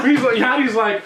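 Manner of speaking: ordinary talk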